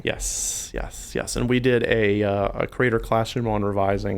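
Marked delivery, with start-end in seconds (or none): 0.0-1.4 whispered. Real, not acted. Agreeing quietly.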